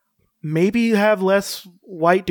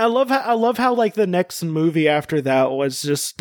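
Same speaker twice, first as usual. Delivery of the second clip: usual speaking voice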